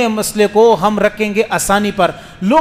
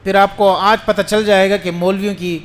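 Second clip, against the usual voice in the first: ordinary talk, nothing marked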